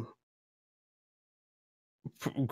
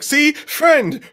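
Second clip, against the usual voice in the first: high, loud acted exclamation; hammed-up pleading yell; frantic reassurance; 7 dB louder than usual. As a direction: shout it as eager proof you are an ally. Cyborg proves it is friendly.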